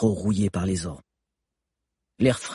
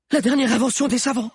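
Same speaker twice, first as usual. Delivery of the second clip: high, breathless explanation; without pausing for breath; 3 dB louder than usual. A narrator's reading. Lunella explaining while fleeing, without catching breath. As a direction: rush it out mid-run. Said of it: "sans reprendre sa respiration".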